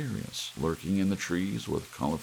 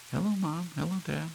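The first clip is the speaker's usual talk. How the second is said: put-on much higher, bright kid greeting; child voices; 13 semitones higher, faster than usual. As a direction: sound like small children.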